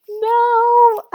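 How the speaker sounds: high, loud wailed no; mock tantrum, one drawn-out wail; dramatic child. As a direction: imitate a whining kid refusing. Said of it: "she was being so dramatic".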